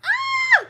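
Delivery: sudden high excited yelp; excited shriek. Real, not acted. Reader squeals at the start of her first live collab.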